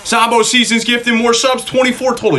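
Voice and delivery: high-pitched hype shout-out; excited announcement. Real, not acted. Streamer announces a viewer's gifted subs, excited. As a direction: call it out high and thrilled.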